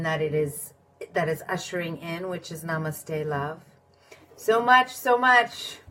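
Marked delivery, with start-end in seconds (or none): 4.3-5.8 high, loud gush of enthusiasm; excited burst. Real, not acted. To her viewers, gushing, excited and loud. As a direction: blurt it out high and bright with excitement. Bursts out about many topics.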